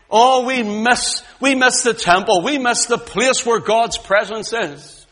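high, loud and slow, crying out the loss; mournful lament. Acted. Exiles by the rivers of Babylon, mourning the temple, wailing. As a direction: wail it like a homesick exile.

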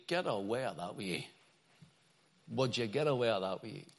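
Ulster Scots dialect, low and soft. Shown as a demonstration. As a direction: say it in a broad Ulster Scots accent. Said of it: "the Ulster language would be".

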